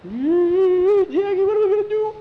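put-on very high, loud frightened boy voice; panicked squeal. A narrator's reading. Skeeter to Jackie in the closet under threat, panicked squeal.

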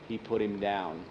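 plain reading voice

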